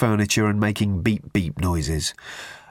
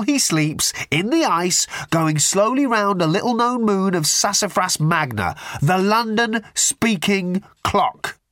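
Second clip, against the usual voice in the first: gleeful Ford; 8 semitones higher than usual. A narrator's reading.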